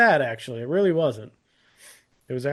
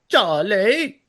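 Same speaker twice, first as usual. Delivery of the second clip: put-on British child accent; 6 semitones higher, 5 dB louder than usual. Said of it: "It's the".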